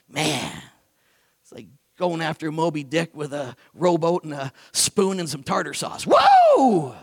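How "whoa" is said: excited whoa. Real, not acted. Excited preacher exclamation.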